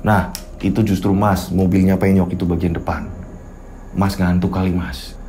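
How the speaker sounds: deep, easy voice of the rider; low calm motorist. Acted. Motorist tells Riko he is drowsy, low and soft.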